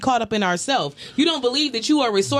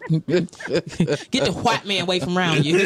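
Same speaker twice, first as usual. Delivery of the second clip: laughing; 7 semitones lower, slower than usual. Real, not acted. Teasing, cracking up.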